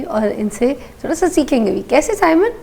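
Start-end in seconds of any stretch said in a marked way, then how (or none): 1.9-2.5 cheery greeting. Real, not acted. Host greets guest on air.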